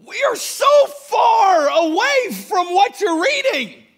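lamenting crowd. Retold or imitated. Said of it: "They're like".

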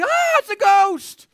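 high, loud, drawn-out cry of fright; mock freaked-out yell. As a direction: imitate terrified men spotting a phantom. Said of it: "they're freaked out".

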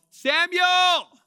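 God's call, loud and high; booming call of Samuel. Shown as a demonstration. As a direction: call his name out loud across the room. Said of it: "Notice that the volume of the voice goes up here".

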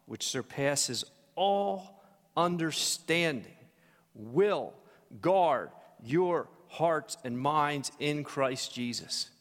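slow, drawn-out reading of the verse; slow scripture reading. Acted. Pastor reading the verse to the church, slow and solemn. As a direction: savor each word of the scripture.

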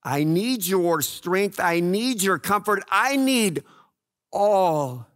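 lightly voiced prayer to God, pleading; a touch of heartfelt pleading; a hint of earnest prayer. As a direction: pray honestly, asking for help. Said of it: "I can go to God and just say".

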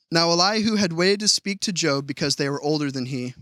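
ordinary talk, nothing marked

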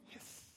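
soft voiceless snake hiss; breathy imitated hiss.

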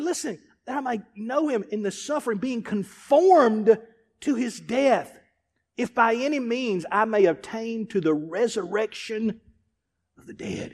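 soft, hushed reading voice; softened scripture reading. Acted. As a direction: read the verse gently, almost to yourself. Reads scripture quietly.

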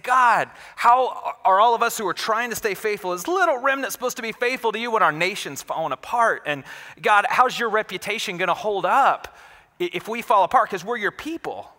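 high-pitched, urgent pleading; wrestling with God. Acted. Habakkuk questions God as the nation falls apart, pleading. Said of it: "that's what he's wrestling with".

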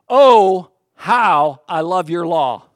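loud, drawn-out exclamation; passionate. Acted. Pastor reading the psalmist's exclamation to the congregation, passionate. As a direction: proclaim it slowly with heartfelt passion. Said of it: "try to capture his passion here".

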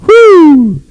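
high happy whoop; real joyful whoop; celebratory whoo. Real, not acted. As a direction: cry out in joy. Preacher's celebratory cry.

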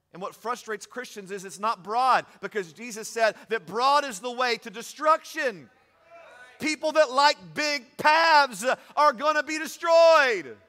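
loud, high preaching with shouted peaks; full-force preaching. Acted. Pastor driving the race rules home to church, shouting preaching. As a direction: preach it hard, building to the shout.

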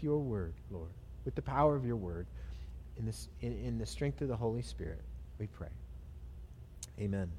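soft, low prayer voice; hushed, low plea to God. Real, not acted. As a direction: pray quietly and earnestly with pauses. Leads a prayer.

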